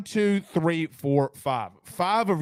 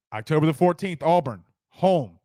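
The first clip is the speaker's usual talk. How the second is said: normal talking voice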